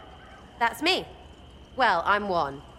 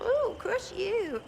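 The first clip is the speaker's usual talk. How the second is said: high, slow swooning mockery; mocking; 9 semitones higher, much slower than usual. Mocking noble threats with high, slow swooning. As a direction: ham up a breathy mock thrill.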